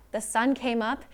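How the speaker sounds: plain reading voice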